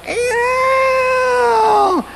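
very high, thin squealing cry; a tiny creature shrieking as it flees; funny demon squeal. Retold or imitated. Demon voice leaving the deaf man, high squeal. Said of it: "A little old funny voice".

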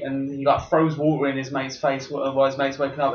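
ordinary talk

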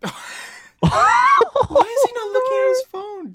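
high, slow, loud desperate plea; frantic. Real, not acted. Host panicking because the kicked guest won't answer. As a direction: panic about the unanswered call.

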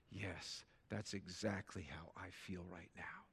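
soft whisper of relief; hushed, slow whisper; whispered agreement. Retold or imitated. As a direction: breathe it out like someone finally understood. Quoting grieving person.

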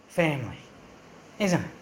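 ordinary talk

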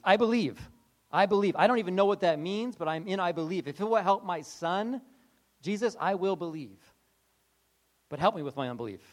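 loud, urgent declaring; eager father. Retold or imitated. Desperate father jumping in to believe, loud and eager. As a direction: blurt it out eagerly, all in. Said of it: "Jesus gives him a hint".

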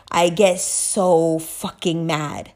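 low, slow, weighted admission of anger; contained anger. Real, not acted. Admits anger, says it low.

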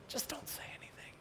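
fast urgent whisper; whispered self-advice. Real, not acted. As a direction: hush yourself quickly.

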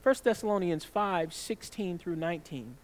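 normal talking voice

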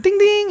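high sung ding ding like a card reader; chirpy electronic ding; register chime imitation. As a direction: mimic the machine's chime, way up high.

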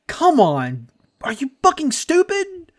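exasperated. Real, not acted. Ranting at clueless pundits.

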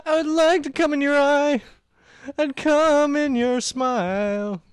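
high, loud goofy dub voice; high silly voice. Acted. Dubbing video, silly voice.

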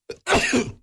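a sudden sneeze on air; sneezing. Real, not acted. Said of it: "Canlı yayında hapşurdu".

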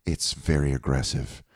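very low, hushed deadpan; soft, serene understatement; calm radio host. Acted. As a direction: deliver a death sentence with no alarm. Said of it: "They're always the calmest about the most crazy shit".

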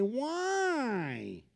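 put-on whiny child. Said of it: "with that whiny voice".

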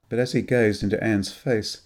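ordinary delivery, nothing marked

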